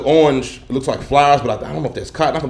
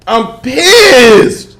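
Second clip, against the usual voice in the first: higher, louder mock-indignant cry; playful outrage, high and loud; emphatic complaint; 10 semitones higher, 7 dB louder than usual. Real, not acted. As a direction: lean hard on pissed, drawn out.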